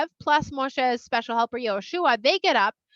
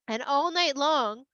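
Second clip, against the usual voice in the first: plain reading voice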